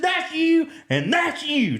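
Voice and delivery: high-pitched, thrilled pointing out; the starstruck cop pointing at each; the cop recognizing the driver and his passenger, excited. Retold or imitated.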